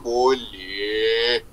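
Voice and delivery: deep, long-held BOLEH; playful low, slow answer; low drawn-out reply. Pretend. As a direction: stretch BOLEH out low and soft.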